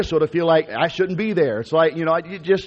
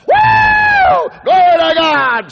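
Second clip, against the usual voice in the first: explosive high shout of praise; sudden joyful shout; 13 semitones higher, 7 dB louder, much slower than usual. Retold or imitated. Preacher friend erupting at midnight in the motel room, shouting. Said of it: "he went".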